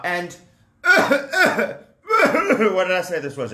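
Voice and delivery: ordinary talk, nothing marked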